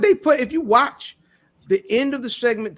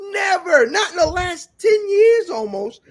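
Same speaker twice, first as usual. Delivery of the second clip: high, loud, slowed rant; exasperated shout; 11 semitones higher, 5 dB louder, slower than usual. Real, not acted. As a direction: yell it out in disbelief. Ranting about wrestling shows.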